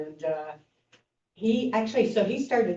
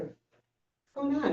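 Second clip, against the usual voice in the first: puzzled monk; 8 semitones higher, 4 dB quieter, much slower than usual. Retold or imitated. Monk wonders at noise.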